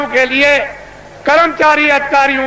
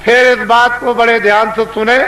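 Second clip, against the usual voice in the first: normal talking voice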